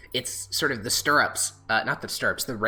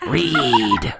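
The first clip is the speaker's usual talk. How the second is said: loud, strange one-word command; a disembodied voice insisting; eerie unseen voice commanding the druid to read; mysterious voice; 9 dB louder than usual. Acted. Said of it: "And you hear".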